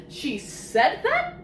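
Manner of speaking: rising, incredulous question; can't believe the kid said that; disbelief. Acted. Reviewer repeating the child's words in mock shock, rising disbelief.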